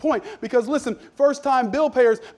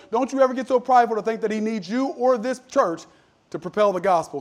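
ordinary delivery

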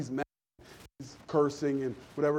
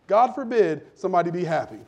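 ordinary talk

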